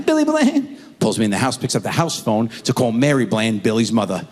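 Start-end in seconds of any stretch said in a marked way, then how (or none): 0.1-0.8 put-on high, whiny kid answer; high-pitched whiny son; high child voice. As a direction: answer like a hurt little boy.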